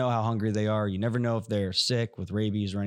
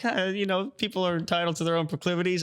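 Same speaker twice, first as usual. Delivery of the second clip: high, breezy mock politician; mock-amused politician impression; imitating laughing it off; 8 semitones higher than usual. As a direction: brush it off in a higher, quicker voice. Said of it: "he was, like, laughing it off".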